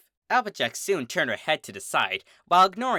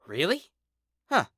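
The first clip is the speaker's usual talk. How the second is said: somewhat surprised; 6 semitones higher than usual. Acted. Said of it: "sounding somewhat surprised".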